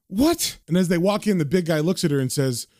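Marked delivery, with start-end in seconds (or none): 0.1-0.6 shocked squeak, rising high; startled high what. A narrator's reading. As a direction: jump up in alarm. Woman startled by intruders.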